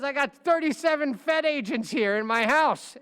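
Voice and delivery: high, loud mock panic; a client telling his lawyer about the raid; panicked client. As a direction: blurt it out like a rattled rich guy. Client reports raid, retold.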